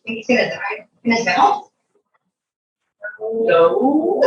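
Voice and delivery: plain reading voice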